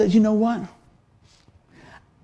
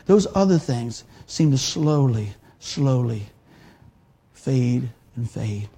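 slowing and fading; 6 semitones lower, slower than usual. Acted. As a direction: let the voice fade away on slowly, slowly, fade and fade. Fading problems, voice fades.

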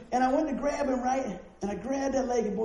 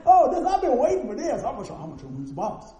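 cocky boast; 9 semitones higher, 7 dB louder than usual. Retold or imitated. Boy boasting before grabbing dad.

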